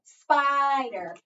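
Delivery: SPI in spider stretched long and loud; stress on first syllable. Shown as a demonstration. Teacher shows where the accent is, SPI in spider stressed. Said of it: "The first syllable".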